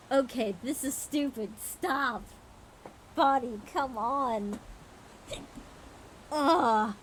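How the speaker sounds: fed up with own body; frustrated. Real, not acted. Fighting hiccups, frustrated.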